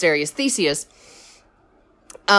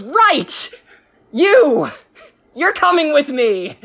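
triumphant call; 5 semitones higher than usual. Retold or imitated. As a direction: bellow like a proud hero who found his friend. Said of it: "finds Asterius and goes".

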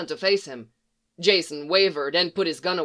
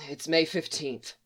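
broken voice; 2 semitones lower, 6 dB quieter than usual. A narrator's reading. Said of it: "Dick's voice sounded broken".

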